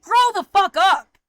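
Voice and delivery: loud, high, drawn-out retort; slow, forceful shout of disgust; angry yell. Real, not acted. Speaker telling the harassers off, angry yell.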